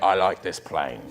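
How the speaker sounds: put-on low, quiet London gangster accent; thick, mumbled Cockney accent. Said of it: "impression of an accent".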